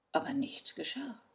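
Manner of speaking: ordinary delivery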